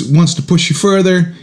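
usual speaking voice

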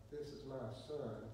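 soft, low throwaway delivery; quiet, underplayed announcement; little, understated voice. Shown as a demonstration. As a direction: say it small and meek, with no force. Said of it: "that wasn't just a little".

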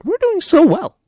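gleeful squeal. Real, not acted. Gleeful about progress.

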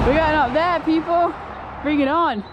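high, loud pumped-up call; excited shout. Real, not acted. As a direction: hype yourself up, calling out. Excited start of the hike.

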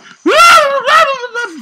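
loud high wailing scream; a long cry of despair at dying; anguished scream. Real, not acted. Streamer screaming in despair as he dies, high and loud.

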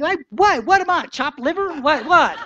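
high, loud, mock-offended disbelief; mock indignation. Speaker passed over by a newcomer, mock offended outrage. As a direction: play it wounded and amused. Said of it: "And I'm thinking".

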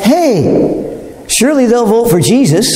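lightly voiced eager Pilate, pitched high at the start; a hint of a scheming governor pleased with himself; Pilate's bright idea. Preacher playing Pilate's sudden bright idea, lightly voiced and high. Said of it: "light bulb goes off".

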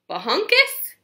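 high, loud questioning bahunkus; a baffled, rising bahunkus?; puzzled bahunkus. Acted. Child puzzling over a strange word, baffled rise.

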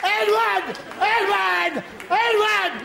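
high calls right over the bed; shouting at him. Retold or imitated. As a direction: try to wake a deaf son.

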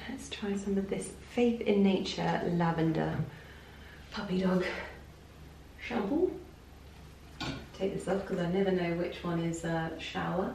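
hushed low voice; quiet. Real, not acted. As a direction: speak softly in the bathroom. Quiet bathroom narration.

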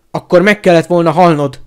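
loud bellow in his face; enraged shouted verdict; furious yell. A narrator's reading. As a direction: roar it with full force.

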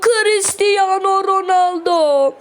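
drawn-out, high star introduction; excited commentator cry. Real, not acted. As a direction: call the name like a stadium announcer. Star player steps up, hyped.

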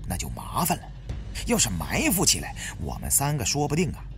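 ordinary delivery

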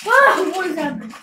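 loud startled cry; startled shout. Real, not acted. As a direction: yelp out in surprise. Sudden chaos in game.

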